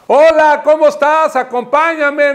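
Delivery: loud, high-pitched shouted greeting; shouting. Retold or imitated. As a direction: shout as if to someone hard of hearing. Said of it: "le empezó a gritar".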